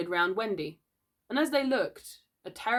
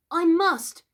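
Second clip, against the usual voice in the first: high, loud, distressed reply; shaken answer; 12 semitones higher, 8 dB louder than usual. A narrator's reading. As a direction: insist through your fear.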